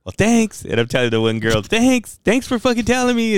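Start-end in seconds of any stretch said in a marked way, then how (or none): 0.0-0.6 high-pitched pointed thanks; acted high sarcastic jab. 1.6-3.3 high-pitched mock gratitude; acted indignant sarcasm, voice way up; sarcastic thanks. Acted sarcastic thanks to one girl.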